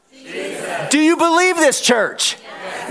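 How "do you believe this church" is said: high-pitched preacher's call; rousing challenge. Real, not acted. Preacher asks church if they believe, rousing challenge. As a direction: demand an answer from the church.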